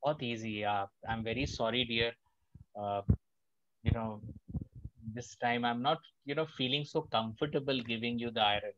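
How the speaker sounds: soft, low, gentle no; calm, easy refusal. Shown as a demonstration. Politely refusing a neighbour the iron, softly. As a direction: turn him down kindly and slowly. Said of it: "You have to be very easy and calm".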